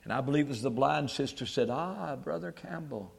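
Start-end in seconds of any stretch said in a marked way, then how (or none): 1.7-3.1 attempted Scottish accent. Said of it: "don't have a good Scotch stick".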